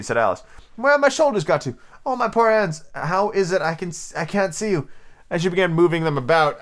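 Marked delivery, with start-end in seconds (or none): 0.8-4.9 alarmed. A narrator's reading. Alice alarmed.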